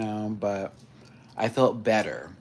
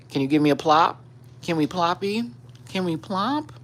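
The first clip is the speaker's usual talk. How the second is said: voicing the cat; 7 semitones higher, 3 dB louder than usual. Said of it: "She's like".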